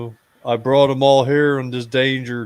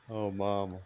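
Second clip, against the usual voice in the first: sad; 5 semitones lower, 10 dB quieter than usual. Acted. Character mourning dying mother.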